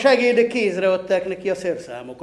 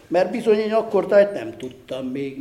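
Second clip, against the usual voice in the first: ordinary delivery, nothing marked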